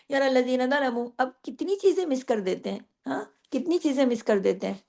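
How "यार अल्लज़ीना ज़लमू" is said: fast careless यार अल्लज़ीना ज़लमू, madd skipped; rushed reading. Shown as a demonstration. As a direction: gabble it quickly. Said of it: "अगर हम जल्दी जल्दी पढ़ते हैं". (जल्दी जल्दी पढ़ना)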